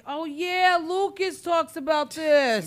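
loud and high, sudden recognition; light up as it clicks; excited realization. Retold or imitated. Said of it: "And I was like".

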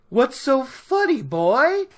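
imitating an affronted bully; confrontational. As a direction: challenge him loudly, acting offended. Offended Whitecloak confronts laughing Rand.

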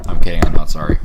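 loud teasing. Real, not acted.

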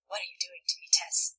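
soft, hushed intimate question; hushed, breath-led tender question; softly. A narrator's reading. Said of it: "he says softly".

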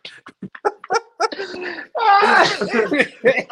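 wheezing laugh, nearly crying; loud high laugh. Real, not acted. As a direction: let the laugh break high. Cracking up at the clip.

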